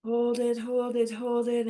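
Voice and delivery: holding the breath. Shown as a demonstration. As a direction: speak while holding breath in. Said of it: "Breathe in".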